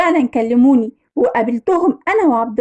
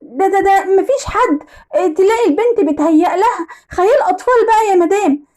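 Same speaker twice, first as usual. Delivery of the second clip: stammering, higher-pitched evasion; flustered; 4 semitones higher than usual. Acted. Maid caught out by her employer, flustered. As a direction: stumble at first, then brush it off. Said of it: "ولقيت سماح ارتبكت وقالت".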